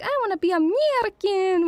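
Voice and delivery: high, eager mock American accent; wannabe American. Growing up wishing to be American, eager American accent. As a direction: put on an excited American accent. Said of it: "nail sounding American".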